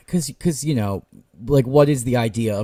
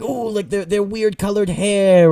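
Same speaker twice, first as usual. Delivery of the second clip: higher, slow airy mock wonder; mock dreamy; 8 semitones higher than usual. Podcaster parodying the book's exotic descriptions, higher and slow mock wonder. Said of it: "it's like".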